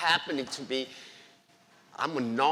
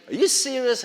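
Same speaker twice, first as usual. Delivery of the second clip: amazed question; 8 semitones higher, 7 dB louder than usual. Retold or imitated. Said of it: "He was like".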